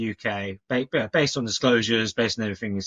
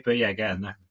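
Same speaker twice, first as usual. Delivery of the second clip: croaky with a cold; hoarse from man flu; faster than usual. Real, not acted. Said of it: "forgive the voice, still a bit man flu-y".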